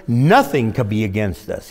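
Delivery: usual speaking voice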